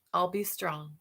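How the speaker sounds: ordinary delivery, nothing marked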